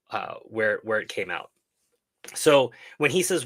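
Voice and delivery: normal talking voice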